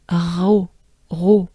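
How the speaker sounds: ro with breathy R into round O; French ro. Shown as a demonstration. Teacher drilling the syllable ro. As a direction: exhale the R, round the O. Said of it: "with a O".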